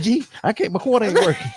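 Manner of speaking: high-pitched whiny imitation; high mock complaint. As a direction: squeak it out like a fussy customer. Imitating her at gum machine.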